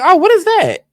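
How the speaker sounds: high-pitched giddy delight; excited gasp. Retold or imitated. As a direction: squeal over a cute guy. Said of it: "I was like".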